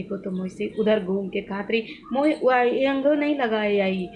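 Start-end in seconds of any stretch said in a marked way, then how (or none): 2.1-4.1 lightly voiced old mother-in-law in Awadhi, a bit higher; mother-in-law in local dialect. Imitating her mother-in-law pointing out the missed spot in Awadhi. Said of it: "मैं इस तरह की language बोल रही हूँ".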